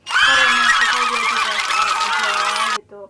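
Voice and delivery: loud rallying shout; shouted cheer. Real, not acted. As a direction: cheer the drivers on.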